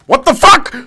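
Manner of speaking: very high, loud disbelieving shout; outraged yell. Real, not acted. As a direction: explode in disbelief. Dog stuck.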